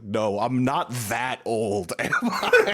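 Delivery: slow, laughing mock protest, the last words high; joking that the fans make you feel old; mock denial. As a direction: drag it out, then laugh it off. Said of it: "and I'm like".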